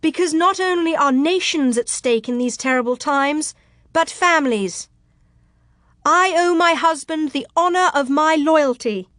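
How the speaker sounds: high, impassioned speech to a crowd; rising, ringing declaration of loyalty; ringing tones. Acted. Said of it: "still letting her voice rise in ringing tones".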